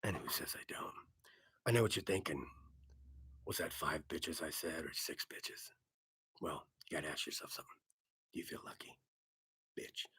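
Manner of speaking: low, whispered tough-guy rasp; low whispered Eastwood impression; gravel tough-guy voice. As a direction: growl it like a movie hard man. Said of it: "He's like".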